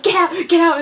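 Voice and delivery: high, loud comic order; hammed-up pushy yelling; mock shooing yell. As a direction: shout it while half laughing. Luke pushing Kylo out.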